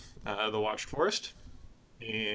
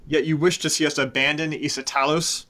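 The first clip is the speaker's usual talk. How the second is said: higher, louder scornful outrage; angry Cyanidel; 5 semitones higher, 8 dB louder than usual. Acted. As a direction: scorn her claim to be an elder. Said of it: "now, he says".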